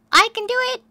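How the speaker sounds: very high and loud mouse voice; volunteer eagerly; eager squeaky cry. A narrator's reading. Barn mouse volunteering to drive, high eager cry. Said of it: "cried Sam".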